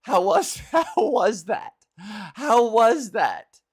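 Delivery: a lightly mimicked prankster asking how it was; coy mimicry. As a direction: drag it out through giggles.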